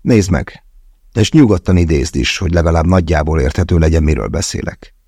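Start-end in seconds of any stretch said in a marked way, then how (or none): none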